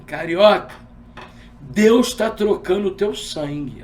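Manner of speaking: loud, slow proclamation from the pulpit; pastor's shout. Retold or imitated. Pastor shouting a prophecy at the visitor, loud and slow. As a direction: call it out like a preacher over the crowd. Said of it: "o pastor gritou assim".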